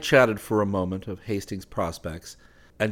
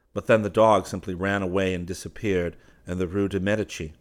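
ordinary delivery, nothing marked

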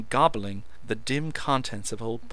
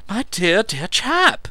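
effusive; 11 semitones higher, 10 dB louder, slower than usual. A narrator's reading. Meadows effusive.

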